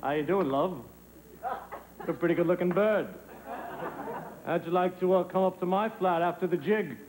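low-pitched pick-up lines; low, would-be suave flirting; chat-up. Acted. Chatting up a woman.

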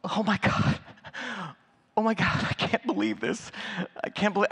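breathy, high, overwhelmed; imitating her shocked disbelief; mock awestruck whisper; stunned gasp. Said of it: "she goes".

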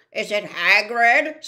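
put-on loud, strange old spider voice; a blind old spider asking. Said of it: "said Aragog".

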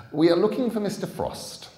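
put-on a gentleman at the door to a girl; smooth stranger. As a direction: ask with oily courtesy.

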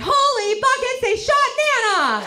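high, loud panicked yell; retold scream. Brother at military funeral, alarmed scream. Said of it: "my brother screamed".

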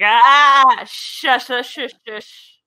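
loud outburst easing into shushing; mock upset. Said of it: "really upset her".

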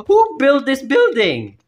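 high, loud panicked question. Real, not acted. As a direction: blurt it out in fright. Panicked after scare.

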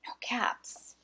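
usual speaking voice

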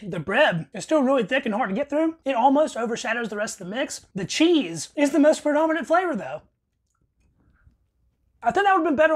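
talking while chewing; muffled by food; mouth full. Real, not acted. Seen 1: Bites at -2s; cheeks bulge, chewing motions while talking.

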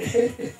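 burst of laughter. Acted. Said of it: "Joseph scoppiò a ridere".

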